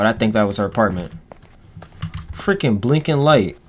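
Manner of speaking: ordinary delivery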